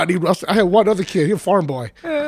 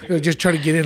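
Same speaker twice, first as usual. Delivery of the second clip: usual speaking voice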